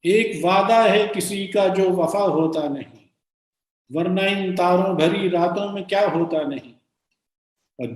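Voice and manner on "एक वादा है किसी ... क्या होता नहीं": sustained, lilting Urdu verse; measured ghazal reading; poem recitation. Acted. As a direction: declaim the couplet, falling at each refrain. Repeats the couplet.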